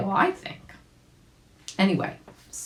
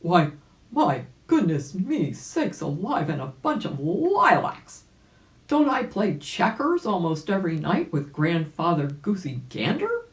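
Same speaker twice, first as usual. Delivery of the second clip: louder mock-indignant old gentleman; indignant old rabbit; 4 semitones higher, 3 dB louder than usual. Old rabbit protesting to the doctor, mock-indignant and louder. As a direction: huff it out like a proud old rabbit. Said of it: "cried Uncle Wiggily".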